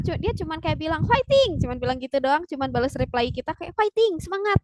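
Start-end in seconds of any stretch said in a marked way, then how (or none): 1.1-1.6 high, loud cheer; bright rallying shout, much higher. Acted. Idol's cheering reply, acted. 3.8-4.6 high-pitched acted cheer; mock idol pep, pitched up. Idol's cheering reply, acted.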